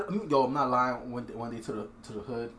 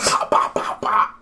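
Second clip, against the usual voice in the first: popping sounds; 8 dB louder, slower than usual. Retold or imitated.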